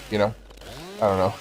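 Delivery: normal talking voice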